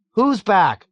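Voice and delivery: high, loud alarmed question; shout. A narrator's reading. Someone else in the panicking ward, shouting. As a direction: shout it across the room. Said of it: "shouted someone else".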